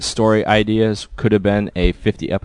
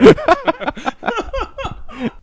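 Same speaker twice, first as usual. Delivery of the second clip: big laugh. Real, not acted. Reacting to joke.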